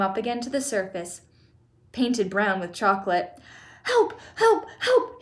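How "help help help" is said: very high cries for help; yelled. A narrator's reading. Drowning boy calling for help, high yells. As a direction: squeal in short panicked bursts. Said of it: "he yelled".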